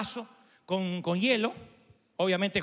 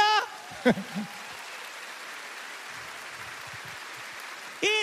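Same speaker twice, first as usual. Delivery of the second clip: laugh; 13 semitones higher, 6 dB quieter than usual. Real, not acted. Preacher laughs mid-story.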